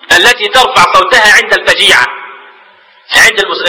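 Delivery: ordinary talk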